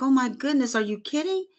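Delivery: high, startled retelling of her own shock; shocked disbelief. Retold or imitated. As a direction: replay the moment the news hit you, pitch jumping up. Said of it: "I was like".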